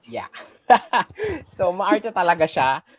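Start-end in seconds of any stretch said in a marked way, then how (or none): 0.7-1.5 laugh. Real, not acted. Laughs at student's comment.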